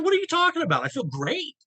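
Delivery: retold high-pitched, incredulous objection; indignant protest. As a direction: push back in disbelief, voice jumping up. Retold argument with the therapist.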